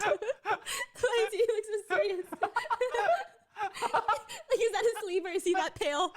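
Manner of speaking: helpless giggle; laughing. Real, not acted. Host laughs at the serious meme face, laughing.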